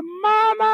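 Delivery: teriakan tinggi sambil menangis; anak menjerit menangis. Retold or imitated. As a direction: jerit minta tolong ke mama. Anak terluka menangis memanggil. (anak menangis menjerit memanggil mama)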